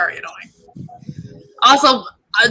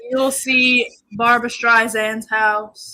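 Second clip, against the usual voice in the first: slow, level sightseeing drone; mock celebrity-home tour patter; bored tour guide; much slower than usual. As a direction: stretch it out, utterly uninterested. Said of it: "sounded like a bored tour guide".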